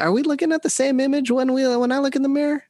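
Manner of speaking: high, rapid incredulous question; mock disbelief. Dad answering kids who don't think he's cool, mock incredulous. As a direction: protest in a high, hurried voice.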